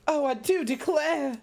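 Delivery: high, slow Southern belle swoon; a genteel lady declaring herself overcome. Pretend. Streamer answers chat as a fainting Southern belle. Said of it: "make you come down with the vapors".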